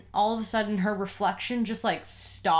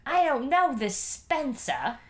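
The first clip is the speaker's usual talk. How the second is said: put-on British accent; 5 semitones higher than usual. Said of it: "She's like".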